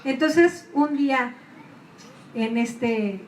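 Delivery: usual speaking voice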